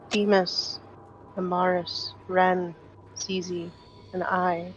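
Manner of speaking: soft, halting prayer with long pauses; hushed, careful plea to an angel, phrase by phrase; reverent prayer. Acted. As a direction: pray quietly, pausing between each name. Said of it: "will start going through a prayer in Celestial".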